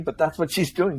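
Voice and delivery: usual speaking voice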